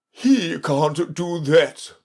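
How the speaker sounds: high, slow kingly retort; drawn-out high voice for a blunt king. A narrator's reading. King contradicting the sulky messenger, blunt.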